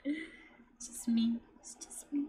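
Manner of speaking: hushed whisper to herself. Real, not acted. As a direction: breathe it, barely voiced.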